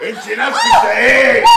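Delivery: very high, loud enraged yell; furious shout. Acted. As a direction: explode at her. Furious outburst at wife. (انفجار غاضب بصوت عالٍ)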